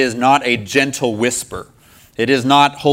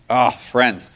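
ordinary talk

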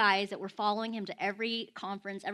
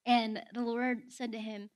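ordinary talk, nothing marked

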